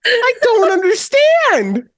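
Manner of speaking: high, slow exasperated wail; wailed bafflement. Retold or imitated. Reenacting confusion over the slogan, exasperated wail. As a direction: wail it out, utterly lost.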